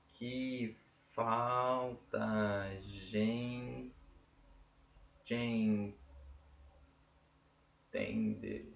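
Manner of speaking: muito lento, sílaba por sílaba; escansão silábica. Shown as a demonstration. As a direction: separe cada sílaba devagar. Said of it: "Deixa eu ver se tá certo". (escandindo o verso devagar)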